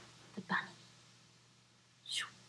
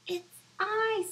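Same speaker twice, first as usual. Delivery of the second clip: high, loud squeaky fly; a tiny fly answering proudly; 14 semitones higher, 3 dB louder than usual. Acted. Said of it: "said the fly".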